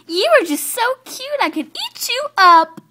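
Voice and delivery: put-on high, loud baby-talk; over-the-top cooing; gushing adoration. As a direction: squeal over something adorable. Gushing over a cute foal.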